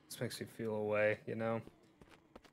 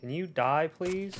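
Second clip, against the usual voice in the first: exasperated; 5 semitones higher, 8 dB louder than usual. Real, not acted. Shooting a zombie.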